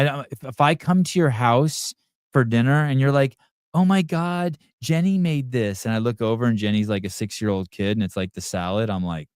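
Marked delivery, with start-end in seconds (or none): 3.7-5.8 mock proud parent. Mocking a proud parent at dinner.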